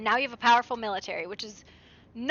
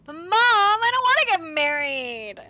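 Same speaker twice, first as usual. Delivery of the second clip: put-on whiny child; 6 semitones higher, 6 dB louder than usual. Whining to mom.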